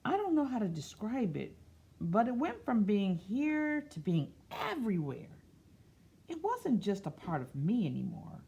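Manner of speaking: picture book voice; reading to children. Acted. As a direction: read it gently to a class. Reads picture book aloud.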